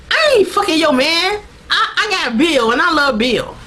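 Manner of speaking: high, indignant mock retort; Sookie. Sookie answers the jealous girlfriend, mock indignation.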